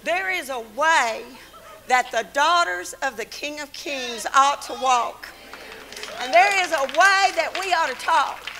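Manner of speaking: loud, rousing sermon climax; full-force preaching. Acted. Preacher rousing daughters of the King, loud. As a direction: preach it out to the room.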